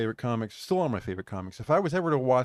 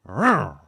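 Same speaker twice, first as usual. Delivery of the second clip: engine roar; 9 dB louder than usual. Retold or imitated. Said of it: "It just went".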